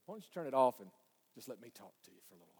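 hushed, low coaxing; inner voice. Acted. Inner voice asking for a moment to talk, hushed. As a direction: drop to a soft, intimate plea. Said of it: "hear that inner voice say".

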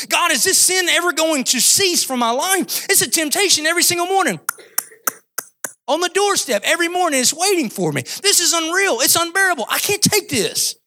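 high, strained cry to God; desperate plea. Acted. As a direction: plead like someone at the end of their rope. Voices a struggler's desperate plea.

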